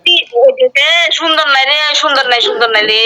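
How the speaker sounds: ordinary talk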